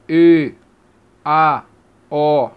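E, A, O held wide open, low pitch; separate, drawn-out open E, A, O; open vowels. Shown as a demonstration. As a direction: sound out open E, A, O one at a time. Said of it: "abertas é".

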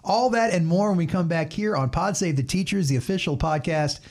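a lightly voiced radio host sign-off; podcast outro. Host closing the segment before the break, upbeat podcast read. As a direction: tease what comes next like a host.

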